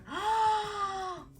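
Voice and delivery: high, drawn-out "aah"; hammed-up wail of dread; mock shocked scream. Reacting as France wins, acted shriek.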